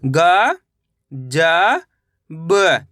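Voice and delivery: each letter drawn out, with a long pause after it; stretched, separated syllables. Shown as a demonstration. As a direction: sound out the letters one at a time for learners. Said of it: "मेरे साथ साथ इनको repeat".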